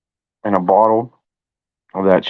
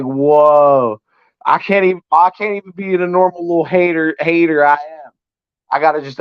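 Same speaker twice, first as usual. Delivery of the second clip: imitating Brandon; 6 semitones higher than usual. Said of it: "He's like".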